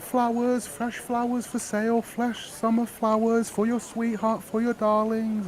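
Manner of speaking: high seller's call across the square; calling out flowers. Acted. Flower girl calling to the crowd as she walks away, high. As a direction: sing out the wares like a flower girl. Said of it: "calling out".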